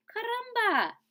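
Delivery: high, loud dramatic exclamations; a flustered duck throwing up her wings; high indignant outburst; duck exclaiming. Acted. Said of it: "cried the duck".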